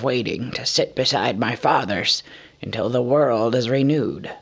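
a fading king's last gentle words; dying dwarf king. Acted. Dying Thorin voiced in narration.

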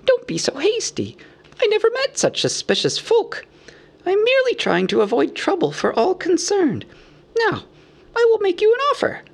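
squeaky, protesting hobbit; Bilbo squeaking. A narrator's reading. Bilbo soothes suspicious Bard, squeaky protest. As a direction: placate them in a very high voice. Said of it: "squeaked Bilbo".

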